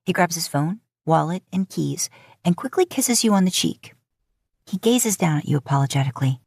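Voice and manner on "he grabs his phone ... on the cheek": ordinary delivery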